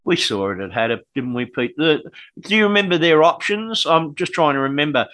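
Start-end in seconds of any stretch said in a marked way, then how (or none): none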